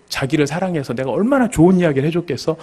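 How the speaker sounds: usual speaking voice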